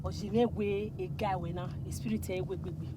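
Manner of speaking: low, soft muttered acceptance; subdued concession. Acted. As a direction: give in quietly, trailing away. Man backs down after partner's plea.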